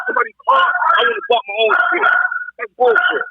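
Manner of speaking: ordinary delivery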